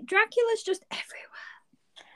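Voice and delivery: breathy spooky whisper. Pretend. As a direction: whisper it like a secret. Spooky joke about Dracula.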